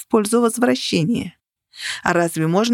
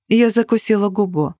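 ordinary delivery, nothing marked

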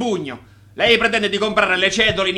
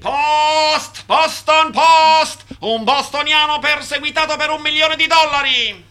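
newsboy cry; 6 semitones higher, slower than usual. Acted. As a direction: hawk the headline to the street. Newsboy crying the headline. (strillone che grida il titolo)

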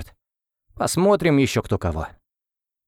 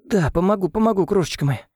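sobbing; 8 semitones higher than usual. Acted. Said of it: "всхлипнула Ангелина".

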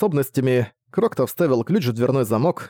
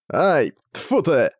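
pained curse; 2 semitones higher, much slower than usual. Acted. Torch falls on his foot.

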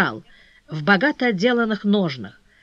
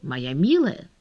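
low, impassive endearment; cool, detached address; dispassionate; 4 semitones lower, 3 dB quieter, slower than usual. A narrator's reading. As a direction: address her without any emotion. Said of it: "продолжал всё так же бесстрастно".

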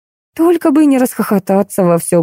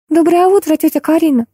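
put-on high girlish twitter; chirping; 10 semitones higher than usual. A narrator's reading. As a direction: chirp a greeting brightly to an aunt. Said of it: "зачирикала гостья". (щебечущий высокий девичий голосок)

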